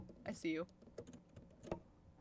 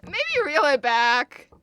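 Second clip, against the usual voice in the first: calling out; 4 semitones higher, 12 dB louder, slower than usual. Real, not acted. Calling to friend on phone.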